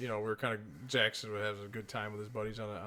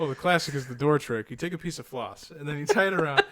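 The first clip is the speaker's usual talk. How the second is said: louder, a laughing teasing threat; teasing scare story; 5 semitones higher, 8 dB louder than usual. Retold or imitated. Uncle telling the boy about the door trick, loud and teasing. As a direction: spook the kid with relish.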